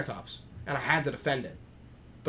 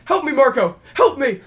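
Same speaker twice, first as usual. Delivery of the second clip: high, loud pleading call for help; mock cry for help; 11 semitones higher, 12 dB louder, slower than usual. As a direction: scream for your friend to save you. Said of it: "he goes".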